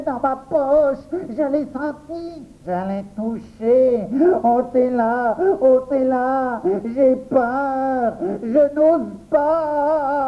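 high, stretched, wailing fright; panicked whimpering. A narrator's reading. Boy too scared to touch his own pocket, panicked wail. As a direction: plead tearfully in a high scared voice.

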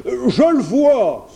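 loud, high, slow bear cry; exclaiming. A narrator's reading. Bear spots the well, exclaiming loudly. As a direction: shout out the discovery as the bear. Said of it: "s'exclama l'ours".

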